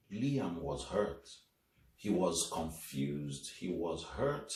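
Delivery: usual speaking voice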